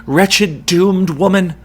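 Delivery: the chorus lamenting to the doomed mother; anguished lament. A narrator's reading. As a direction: mourn aloud in horror. Chorus lamenting the children's cries.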